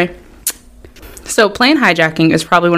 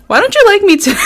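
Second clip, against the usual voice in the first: mock whiny. Mock hurt whine, laughing.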